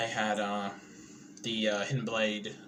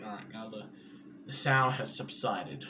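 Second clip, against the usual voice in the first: ordinary talk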